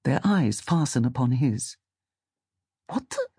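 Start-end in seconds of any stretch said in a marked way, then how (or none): 2.9-3.3 very high, soft gasp of words; stunned disbelief. Acted. Fisherman staring at octopus heads, stunned. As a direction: break off in hushed astonishment. Man sees octopuses looking at him.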